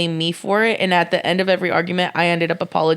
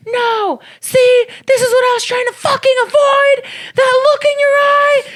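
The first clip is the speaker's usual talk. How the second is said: much higher, louder hammed-up upset boyfriend; angry boyfriend; 4 dB louder than usual. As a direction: play the furious boyfriend in a mock whiny voice. Angry boyfriend voiced in quarrel.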